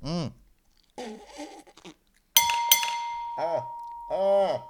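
chewing a nasty bean; eating gross jelly beans. Real, not acted. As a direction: groans through a mouthful. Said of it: "I just bit into that".

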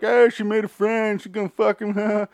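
high, slow, taunting put-on voice; mocking counselors. Camp counselors teasing Margaret, high slow mocking voice. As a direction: tease her in a high mocking singsong. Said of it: "camp counselors are like".

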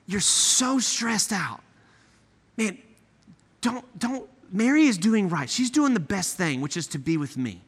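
quiet, caring Jesus soothing Martha; compassionate. Acted. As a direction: gently reassure an overwhelmed friend. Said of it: "Full of empathy, full of compassion".

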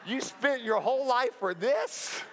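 a God who can't believe what he's seeing; lightly voiced God asking in disbelief; God unimpressed. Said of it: "He's like".

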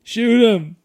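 high, very loud mock yell; screaming. Podcast host joins in his buddy's screaming bit, screaming. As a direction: shout the order at full volume. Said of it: "I could see myself screaming".

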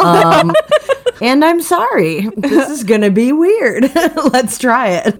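laughing helplessly before an awkward attempt; nervous laughing. Real, not acted. Player apologizing to British listeners before attempting an accent, laughing. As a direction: apologize through high-pitched giggles.

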